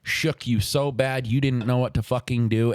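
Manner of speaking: ordinary talk, nothing marked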